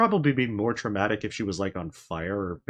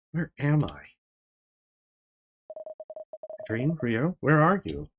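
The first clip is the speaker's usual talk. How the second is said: a touch of fear, calling out higher; lost and frightened; 4 semitones higher than usual. Acted. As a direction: search the void for friends.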